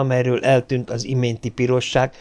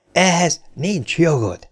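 higher, slow snarl of outrage; snarling protest; 6 semitones higher, slower than usual. Acted. Bogert leaps up, snarling at Lanning: higher outraged snarl. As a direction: spit it out, leaping up red-faced. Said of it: "vicsorogva, bíborvörös arccal felpattant".

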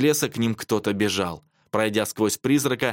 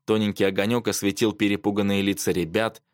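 ordinary delivery, nothing marked

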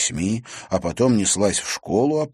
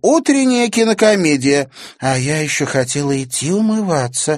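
amused; 8 semitones higher, 7 dB louder than usual. Acted. Girls laughing at window.

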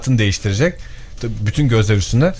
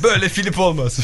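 mock indignant quote; 8 semitones higher than usual.

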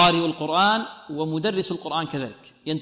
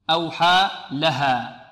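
slow أوحى لها with a clear break before لها; two separate words, أوحى / لها; separated words; much slower than usual. Shown as a demonstration. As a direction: say أوحى, stop, then لها. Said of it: "مفصولة".

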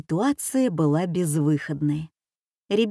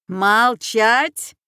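loud, raised command; shouted order; 4 semitones higher, 6 dB louder than usual. A narrator's reading. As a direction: shout to silence the captives. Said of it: "опять закричала женщина". (крикнуть властно)

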